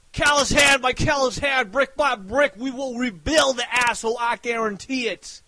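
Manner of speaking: usual speaking voice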